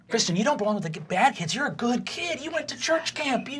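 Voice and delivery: high-pitched, pleading coach voice; dismayed coach. Acted. Game master voices a dismayed coach.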